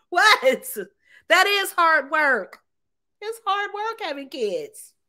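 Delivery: high, drawn-out mock outrage; mock protest. Woman mock-protesting that having kids is hard work, indignant. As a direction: start loud and high, stretch out the protest.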